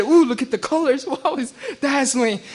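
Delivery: high, laughing, dazzled gasp; excited. Retold or imitated. Merchant finding the treasure, gushing and laughing with excitement. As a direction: gush over the shiny treasure. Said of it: "he got all excited".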